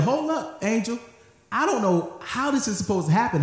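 high, incredulous hold-up voice; sassy Mary. Acted.

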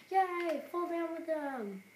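high, loud gleeful cheer; excited cheer. Real, not acted. Gamer cheering a collapse, high and loud. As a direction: cheer it on as it falls.

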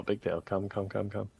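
ordinary talk, nothing marked

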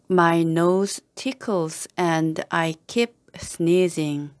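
slow, every word given its own space; slow model reading. Shown as a demonstration. Teacher modelling the sentence for learners, slow and careful. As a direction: spell it out slowly for the class. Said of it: "teacher models slow first reading".

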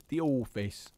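slow, drawn-out "office" as "orfice"; phonetic dialect pronunciation. Shown as a demonstration. Showing listeners the odd spelling, "office" slowly said as "orfice". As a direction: draw "office" out with a long "or". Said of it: "the word office is spelled O R F I C E".

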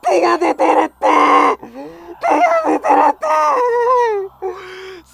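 loud terrified drawn-out scream; hit hard crying out. Real, not acted. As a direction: scream in terror, stretched out. Hand comes out, screams.

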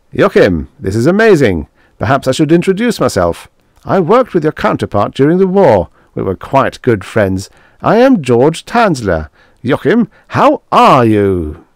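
put-on an old friend delighted to see him; eager colleague. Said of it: "The man was all eagerness, all enthusiasm".

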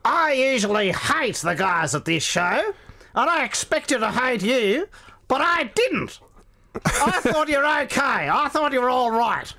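broad Australian accent, hammed up and high. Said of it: "he genuinely went".

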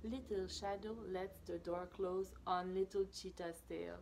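plain reading voice

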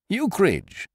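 fussy friend's sudden cry; dismayed cry. Acted. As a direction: exclaim the name in alarm. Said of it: "cried George Tupper".

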